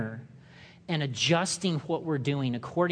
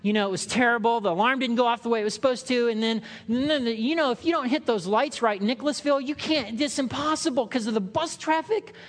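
higher, rising, rushed flurry of excuses; flustered excuses; 6 semitones higher than usual. Retold or imitated. Preacher plays himself late, making excuses. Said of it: "But then I'm late for class".

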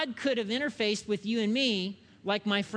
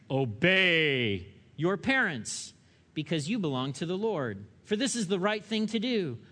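scripture reading; 3 semitones lower than usual. Acted. Reads scripture aloud.

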